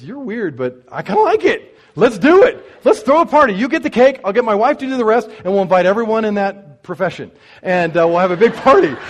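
loud, high, eager agreement; a cook who's suddenly all in, voice up and loud; excited diner guy. Retold or imitated.

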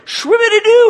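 a high, loud playful magic-wand noise; magic poof sound. Acted. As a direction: sing out a silly conjuring sound. Magic sound for creating servant.

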